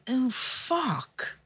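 soft, dismayed under-breath reaction; muttered mock reaction. Therapist mutters in dismay at the patient's confession, softly.

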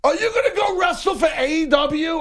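high excited fan. Retold or imitated. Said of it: "he's like".

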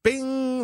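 a high, bright "Bing!" like a kitchen timer going off; ping it out like the oven beeping; imitated oven beep.

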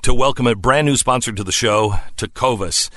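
lively, lifted sponsor pitch; radio ad read. Acted. Host welcoming a new boot sponsor, ad read. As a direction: sell it with energetic emphasis.

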